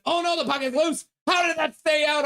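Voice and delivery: high, loud disbelief at the save; excited hockey call. Real, not acted. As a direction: yell at the near goal. Near goal in hockey game.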